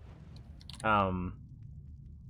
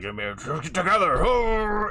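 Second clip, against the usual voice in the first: giant bellow; 7 semitones higher, 5 dB louder, much slower than usual. Acted. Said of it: "the giant reaches over, like".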